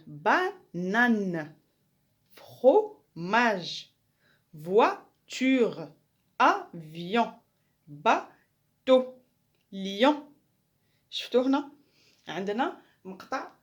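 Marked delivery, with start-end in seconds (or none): 0.1-9.1 بانان، فروماج، فواتور said slow and loud, each syllable separated; slow loud syllable split. Shown as a demonstration. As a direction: stretch out each syllable of فروماج and باتو for the class. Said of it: "هنا عندي كلمة".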